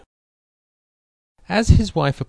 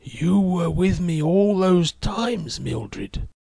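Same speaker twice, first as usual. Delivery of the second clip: slow, higher, soft and weak; 6 semitones higher, slower than usual. Acted. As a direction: speak weakly from the sickbed, slowly. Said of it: "replied in a soft, weak voice".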